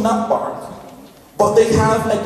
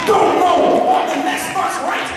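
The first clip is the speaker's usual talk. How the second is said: higher, rhythmic shouted sermon refrain; preaching at full force; 7 semitones higher than usual. Acted. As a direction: drive the refrain home to the congregation. Preaching climax over music.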